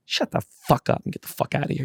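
a hint of a hoarse, breathy tough guy; breathy mock gangster. Pesci dismissing the caddy.

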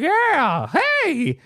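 put-on high-pitched old man greeting; old George calling out high; old man neighbor. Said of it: "He's like".

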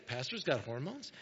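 low, hushed aside as a listener; mock surprised aside. Churchgoer reacting to the pastor's hormones, mock hushed aside. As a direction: drop the voice for a sly imitated murmur. Voices a congregant's muttered reaction.

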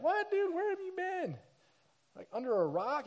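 can't believe he hasn't heard; incredulous disbelief. Retold or imitated. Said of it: "and they're like".

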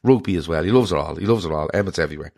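ordinary delivery

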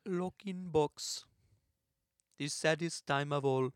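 put-on hushed French-accented translation. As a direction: speak softly for the mime in mock French. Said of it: "He's doing a French accent".

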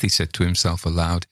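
ordinary delivery, nothing marked

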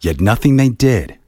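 plain reading voice

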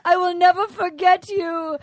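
high, gushing suitor impression; Benoit. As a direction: declare it with hammed-up devotion. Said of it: "And then he goes".

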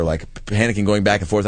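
ordinary talk, nothing marked

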